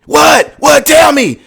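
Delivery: loud, high mock yell of protest; imitating yelling. Retelling a man's breakup outburst to viewers, imitated yelling. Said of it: "he's yelling out like that".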